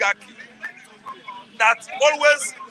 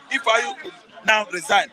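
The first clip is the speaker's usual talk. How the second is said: normal talking voice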